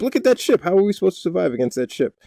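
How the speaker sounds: high, fast mock panic; hammed-up alarm at a daunting sight; alarmed exclamation. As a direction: blurt it out in playful alarm. Acted alarm at enemy ship.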